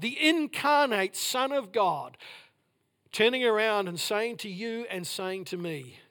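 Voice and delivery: ordinary talk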